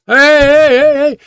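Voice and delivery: high-pitched mock hollering after a car; calling out. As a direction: imitate a woman urgently flagging someone down. Woman runs out stopping a driver.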